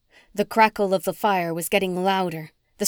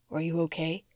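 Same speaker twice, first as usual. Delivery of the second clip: much softer, tender question; gentle concern; 3 semitones lower, 8 dB quieter than usual. A narrator's reading. Fiancé gently asking his shaken partner, soft. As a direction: ask softly, checking on her. Oscar checking on her.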